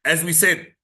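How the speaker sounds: ordinary talk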